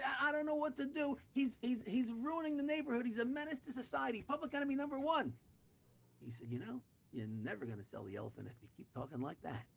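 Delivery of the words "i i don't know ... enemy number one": high-pitched, distraught tirade; hammed-up despairing complaint; exasperated rant. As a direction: build to an outraged verdict at high pitch. Buyer rants about the awful elephant.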